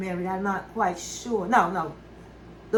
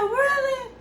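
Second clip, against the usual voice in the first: high-pitched, imitated "really?"; acting out a surprised reaction. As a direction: voice her pleasant disbelief, way up high. Acting out her surprised reply.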